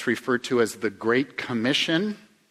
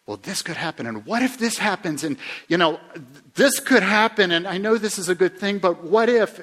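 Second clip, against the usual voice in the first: high, rising panicky fretting; worried what-if voice; 8 semitones higher, 6 dB louder than usual. Retold or imitated. Imitating an anxious listener, worried and pitched high. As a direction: mimic a worrier spiralling into what-ifs. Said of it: "Are, are you a bit that way?".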